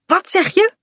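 surprised. Acted. Surprised at pancakes.

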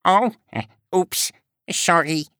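high, hesitant duck voice; apologetic duck. A narrator's reading. Duck apologizing to the frog, hesitant and high.

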